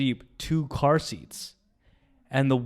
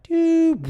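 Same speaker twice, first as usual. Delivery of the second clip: high, loud "doo" sound effect; imitated blast noise; 7 dB louder than usual. Storyteller likening the crash to Saving Private Ryan, imitated blast noise. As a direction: vocalise a shell whistling in, high and loud.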